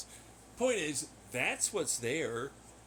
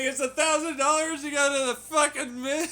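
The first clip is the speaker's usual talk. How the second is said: much higher, louder hammed-up pitchman; mock sales pitch; 12 semitones higher, 8 dB louder than usual. Pitching cheap moon trips to tourists, hammed-up salesman. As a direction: sell it like a cheesy ad.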